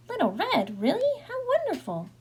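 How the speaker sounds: very high, swooping delight; delighted grandmother. A narrator's reading. Grandmother hearing Little Red at the door, delighted. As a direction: coo with joy at a surprise visitor.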